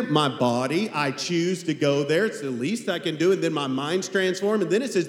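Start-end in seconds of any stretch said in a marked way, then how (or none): none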